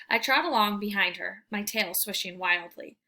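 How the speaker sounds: ordinary delivery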